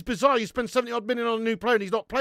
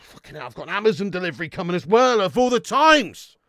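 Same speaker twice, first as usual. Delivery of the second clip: exasperated; 3 semitones lower, 7 dB louder than usual. Real, not acted. Annoyed about delivery timing.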